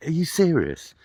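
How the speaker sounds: can't believe his luck; amazed disbelief. Retold or imitated. Homeless man amazed at the offer, high disbelief.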